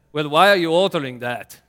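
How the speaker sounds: put-on loud Austrian accent, pressing. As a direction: push the question in Austrian accent. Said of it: "in my best kind of Austrian accent".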